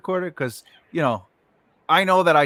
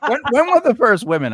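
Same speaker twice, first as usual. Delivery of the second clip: amused; 6 semitones higher, 5 dB louder than usual. Real, not acted. Joking question amid laughter.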